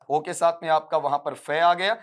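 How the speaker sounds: usual speaking voice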